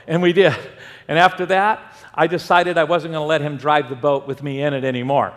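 ordinary talk

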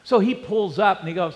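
normal talking voice

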